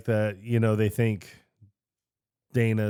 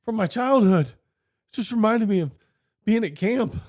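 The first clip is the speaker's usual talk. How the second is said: put-on high, sweet childlike voice; innocent childlike voice; 10 semitones higher than usual. Ray explaining his thought to the others, high innocent childlike voice. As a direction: play it like a guileless kid remembering camp. Voicing Ray's innocent excuse.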